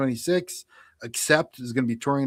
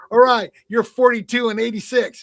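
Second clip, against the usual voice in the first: raised, louder mock quiz host; hammed-up scorekeeper, pitched up; random score announcing; 8 semitones higher, 7 dB louder than usual. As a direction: mimic your own score call, high and loud. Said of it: "the way I was saying it".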